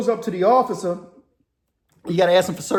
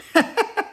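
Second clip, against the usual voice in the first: very high real giggle. Real, not acted. Storyteller after his gibberish run, high giggle. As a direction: break up laughing at your own bit.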